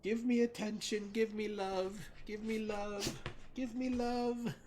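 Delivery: very high-pitched pleading whine; dog begging in a squeaky high voice, drawing out the last plea; whining needy dog. Retold or imitated. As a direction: whine for attention like a needy pup. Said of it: "starts whining, and she's like".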